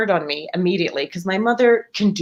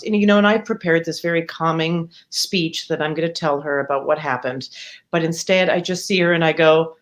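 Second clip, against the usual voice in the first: usual speaking voice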